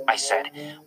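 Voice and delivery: ordinary delivery, nothing marked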